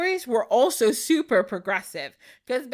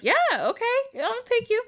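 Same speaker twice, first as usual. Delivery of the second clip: lightly voiced stranger, pitched up; gruff helper voice; 12 semitones higher than usual. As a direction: toss off a quick yes. Man agrees to take princess.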